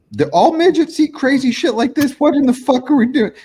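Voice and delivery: high mock-incredulous voice; baffled onlookers. Viewers gawking at a weird food photo, mock incredulous. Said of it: "people are looking at this shit like".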